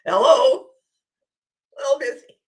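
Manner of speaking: loud, high mock phone answer; busy brush-off. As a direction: answer like someone too busy to talk.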